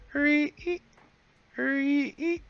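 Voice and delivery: very high, thin, eerie little voice; a small ghost child calling from nowhere. Acted. Little ghost urging the party, very high and eerie.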